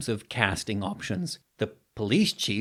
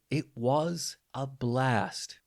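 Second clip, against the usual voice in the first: ordinary talk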